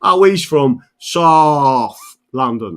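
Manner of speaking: loud, drawn-out "Sahf" London jibe; exaggerated South London accent, slow and loud; mocking South London accent. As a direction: take the piss out of South London speech. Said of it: "this is where they take the piss out of us".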